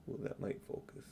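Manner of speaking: ordinary delivery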